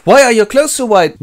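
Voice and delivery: high, loud astonished question; amazed. Acted. Friends question returned wizard, loud amazement. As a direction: blurt it out in wonder.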